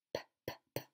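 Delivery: repeated short pops of "P"; isolated P sound. Shown as a demonstration. Teacher asking children to listen, repeated isolated pops. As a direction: pop "P" as a bare consonant, three times. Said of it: "est-ce qu'on entend le son".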